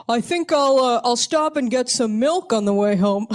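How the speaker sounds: high, loud, casual phone chatter; loud phone talker. Retold or imitated. Guy on his phone in the library, talking loudly and casually. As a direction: boom it into a cell phone, oblivious. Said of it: "this guy's over here being like".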